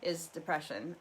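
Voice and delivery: normal talking voice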